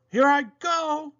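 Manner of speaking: high, loud eager cry; little engine takes the horse's dare. Acted. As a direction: launch into the race. Toodle starts racing.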